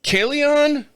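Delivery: high, unsure "Kalion?"; puzzled guess. Real, not acted. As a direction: squint and guess at the word. Squinting to read label.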